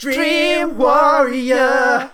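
long high drawn-out shout; franchise noise. Pretend. Host asked for his franchise noise, he bellows it long and high. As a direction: belt out the title like a battle cry. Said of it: "what's your franchise noise".

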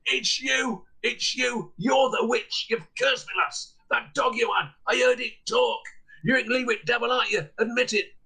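high, furious accusing yell; accusing shout. Acted. Peddler accusing Alison from his sickbed, yelling. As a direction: point and scream at the witch.

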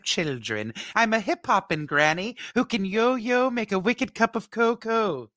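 high-pitched nanny character voice; movie quote in a put-on high voice; Missus Doubtfire. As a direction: pitch it way up as a fussy film nanny. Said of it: "I know that movie by heart".

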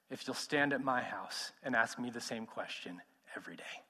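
soft whisper of a tempting offer. Acted. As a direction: whisper it, half to himself. Rabbi's quiet offer.

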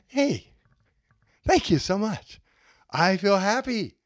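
high, bright, overjoyed thank-you; delighted thanks. Shown as a demonstration. Thanking Mike for saying yes, overjoyed. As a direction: gush with surprised joy.

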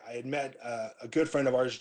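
normal talking voice

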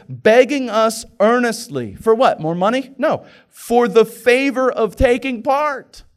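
slow, loud, pressing scripture read; preaching at full force. Acted. Preacher to his church, reading verse at full force. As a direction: drive each word home.

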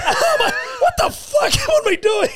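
very high, laughing mock outcry; exasperated disbelief. Teller replays his reaction to the husband, high exasperated outcry. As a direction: squeal it in hammed-up disbelief.

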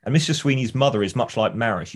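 normal talking voice

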